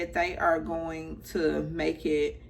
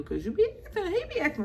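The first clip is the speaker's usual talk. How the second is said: sassy teasing; 11 semitones higher, much faster than usual. Retold or imitated. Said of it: "And I'm like".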